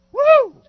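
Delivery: very loud high whoop; whooping yell from the cold. Real, not acted. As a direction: whoop through the freezing shock. Ice water dumped on him.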